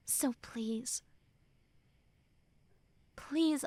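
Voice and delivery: breathy pleading whisper; whispered plea. Acted. Friend pleading in a whisper for the listener to feel.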